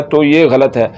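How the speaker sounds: ordinary talk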